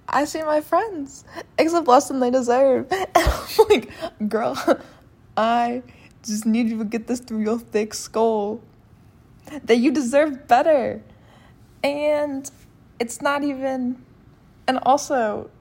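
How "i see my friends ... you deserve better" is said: exasperated, louder outburst; worked-up frustrated rant. Real, not acted. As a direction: vent in exasperation at a friend. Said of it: "I guess I'm frustrated".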